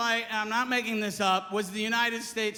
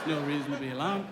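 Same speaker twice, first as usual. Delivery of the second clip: very low, calm mock pilot; voice of death; 9 semitones lower, 3 dB quieter, faster than usual. Pilot soothing doomed passengers, deep voice of death. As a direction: drop deep and eerily mellow. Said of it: "that's the voice of death".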